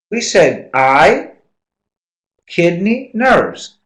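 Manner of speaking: each word slowly spelled out; emphatic list. Real, not acted. Lecturer to class who missed it, slow and emphatic. Repeating the answer, exasperated.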